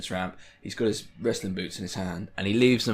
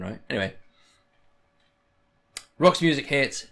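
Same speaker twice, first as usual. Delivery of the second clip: ordinary talk, nothing marked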